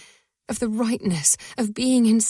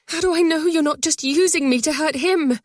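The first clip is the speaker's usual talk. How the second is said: distressed; 7 semitones higher, 4 dB louder than usual. A narrator's reading. Doubting outburst, higher and louder.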